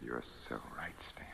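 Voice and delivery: hushed, sinister whisper; menacing whisper. Acted. Villain whispering to his victim, menacing whisper. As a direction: breathe it out like a threat.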